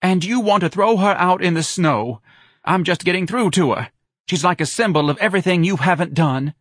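high, loud, excited reproach; triumphant wife. A narrator's reading. Wife triumphantly berates husband.

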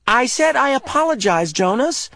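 impatient. A narrator's reading. Asher cutting Jonas off in exasperation. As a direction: cut him off sharply.

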